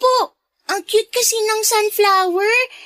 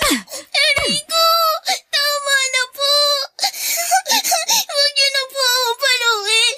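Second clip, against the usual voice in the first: higher, sobbing pleas, slow at first; crying in pain; 3 semitones higher than usual. Acted. Abigail begs Aline to stop hitting her, sobbing. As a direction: beg through tears to make it stop. Girl being hit, begs for help.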